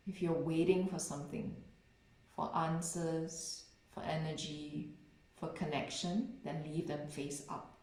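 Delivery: unhurried, settling delivery, letting each phrase rest; guided meditation. Real, not acted. Meditation teacher easing listeners into stillness, guided meditation voice.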